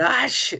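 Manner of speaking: mimed sneeze, high "ah-sh"; estornudo moderado. Shown as a demonstration. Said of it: "un estornudo moderado". (imitando un estornudo agudo)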